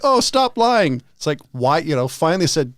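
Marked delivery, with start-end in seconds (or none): 0.1-1.0 dismissive. Retold or imitated.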